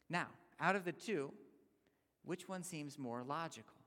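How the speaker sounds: ordinary delivery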